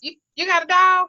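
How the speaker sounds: doubtful high question. Retold or imitated. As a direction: raise an eyebrow. Mock dialogue with client.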